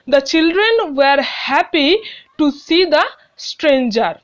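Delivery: reads the sentence very slowly, word by word; slow, careful classroom read-aloud; slow dictation reading. Shown as a demonstration. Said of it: "teacher reads exercise sentence aloud".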